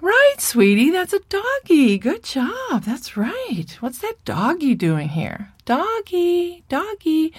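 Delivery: put-on high-pitched sing-song mommy talking to a toddler; encouraging mother. Mother praises her toddler for saying doggy, high encouraging baby-talk. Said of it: "his mother probably will say".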